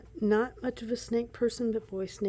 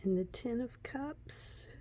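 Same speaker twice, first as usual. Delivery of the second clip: slow softer whisper; 4 semitones higher, 5 dB quieter than usual. Real, not acted. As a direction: breathe the title. Whispers the card name.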